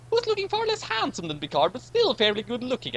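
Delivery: very high, catty aside; high-voiced catty aside about Riker; high character voice. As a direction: pitch way up and tease him. Retake of same high line.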